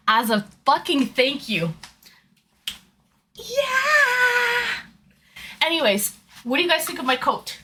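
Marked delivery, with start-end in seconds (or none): 3.3-4.8 high, far-off cheer; high shout. Real, not acted. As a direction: let out a high yeah. Cheer after promise.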